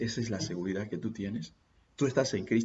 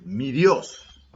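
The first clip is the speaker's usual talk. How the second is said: exclaimed; 7 semitones higher, 11 dB louder than usual. Acted. Preacher exclaims Daniel's words.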